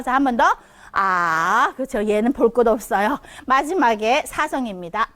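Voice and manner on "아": 아 long and low, then rising; third tone. Shown as a demonstration. Teacher asks for one more, dipping 아. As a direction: dip 아 down before bringing it up. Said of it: "레, 도, 파 이렇게 가요".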